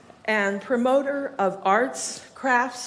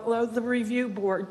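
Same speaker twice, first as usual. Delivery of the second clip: ordinary delivery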